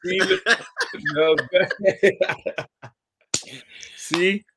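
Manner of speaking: high, laughing teasing "see". Real, not acted. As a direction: crack up while pointing it out. Laughing at the jab.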